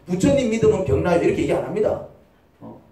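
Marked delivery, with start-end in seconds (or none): none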